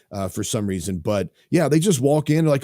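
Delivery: usual speaking voice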